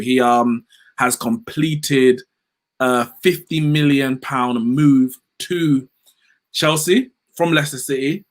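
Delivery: normal talking voice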